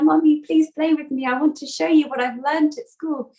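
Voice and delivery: put-on a child pestering mum to play; eager child Ganesh. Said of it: "Ganesh says to her".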